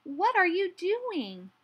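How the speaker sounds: high, slow, loud call; called-out question. A narrator's reading. Little Hedgehog calling to Otter by the river, shouted. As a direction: call it over, drawn out and high. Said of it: "shouted Little Hedgehog".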